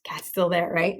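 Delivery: whispered aside. Real, not acted. Teller whispering to listening children. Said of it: "whispered aside".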